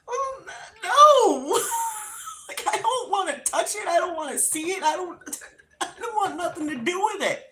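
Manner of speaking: high, wailing protest; hammed-up tearful begging off; panicked refusal. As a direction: whine it in mock terror, speeding up. Retold panicked refusal to help mom.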